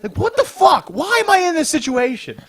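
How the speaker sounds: mock exasperated disbelief; incredulous. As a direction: ask it in stunned disbelief. Disbelief retelling awkward scene.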